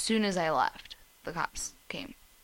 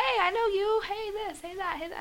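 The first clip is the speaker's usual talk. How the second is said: chirpy greeter. Retold or imitated. Acted quote of everyone greeting.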